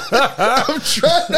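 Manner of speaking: laughing. Real, not acted. Laughing while starting to speak.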